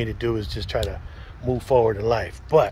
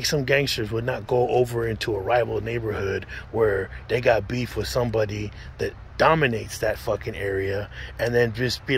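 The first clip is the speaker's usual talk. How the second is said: ordinary delivery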